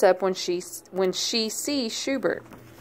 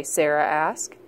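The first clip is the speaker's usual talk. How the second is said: ordinary delivery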